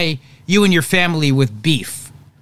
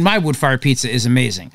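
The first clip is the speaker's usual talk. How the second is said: ordinary delivery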